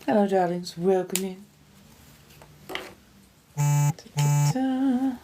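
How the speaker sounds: ordinary talk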